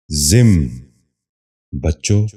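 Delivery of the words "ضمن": ضمن in one syllable, "zimn"; correct pronunciation. Shown as a demonstration. Teacher giving viewers the model, correct pronunciation. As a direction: say ضمن as zimn, no second vowel. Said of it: "دوبارہ سنیے".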